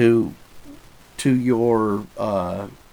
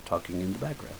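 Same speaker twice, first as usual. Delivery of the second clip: NPR voice; 3 semitones lower, 10 dB quieter than usual. Shown as a demonstration. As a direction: murmur like an NPR host. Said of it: "And I will be N P R".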